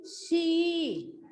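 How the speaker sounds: "शी" with the ee held long; long ee vowel. Shown as a demonstration. Teacher modelling the matra for students, long-drawn "शी". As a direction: stretch out the vowel of "शी". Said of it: "लंबा होता है ना".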